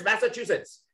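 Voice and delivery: ordinary talk, nothing marked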